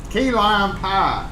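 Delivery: high, drawn-out key lime pie; Southern accent. Shown as a demonstration. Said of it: "my southern accent made it sound like key lime".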